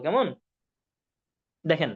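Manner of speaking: ordinary talk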